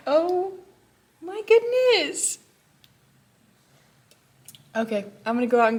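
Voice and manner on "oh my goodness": much higher, louder, slow oh my goodness; real delight at the Secret Santa gift; delighted squeal. Real, not acted. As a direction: stretch it out, thrilled to bits. Gift overwhelms her.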